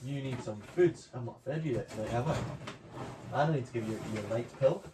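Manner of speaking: ordinary talk, nothing marked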